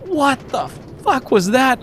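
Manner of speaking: high, panicked imitation of the cop; freaked-out yelp. Cop reacting to the craft overhead, high panicked yelp. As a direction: squeal it out in total alarm. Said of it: "he freaked out".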